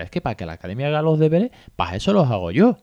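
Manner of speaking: a hint of a boastful know-it-all; cocky, smug. Acquaintance boasting he does the homework himself, lightly cocky. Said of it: "Así como con chulería me decía".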